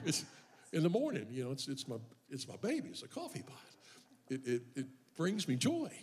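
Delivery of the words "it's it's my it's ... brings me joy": ordinary talk, nothing marked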